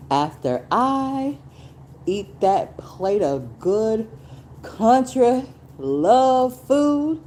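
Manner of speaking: high, slow and relishing; savoring delight. Real, not acted. Dreaming aloud about her dinner, high and drawn out. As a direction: draw out the country love food lovingly.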